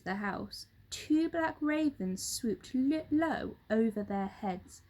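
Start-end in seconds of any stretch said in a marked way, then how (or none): none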